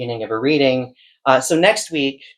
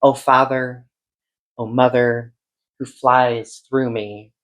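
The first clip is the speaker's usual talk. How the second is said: slow, measured recital with lingering line ends; incantatory, deliberate poem delivery; poetry reading; 3 dB louder, much slower than usual. Acted. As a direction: read it as a poem, drawing out each phrase and pausing between images. Reads a poem aloud.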